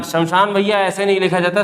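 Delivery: ordinary talk, nothing marked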